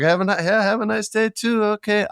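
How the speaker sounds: very high, sing-song goodbye; sweet farewell. Retold or imitated. Saying goodbye to the dog owner, high sing-song. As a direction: gush a friendly goodbye. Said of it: "pleasant goodbye to dog owner".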